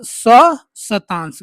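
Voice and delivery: सहशतांश said very slowly to correct the board; slow correction. Shown as a demonstration. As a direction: spell out सहशतांश carefully. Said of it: "गलत लिखा गया सहशतांश".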